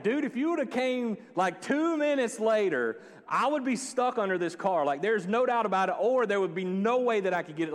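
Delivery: retelling a near disaster, thrilled and shaken; amazed, excited. Retold or imitated. Retelling near miss under jeep.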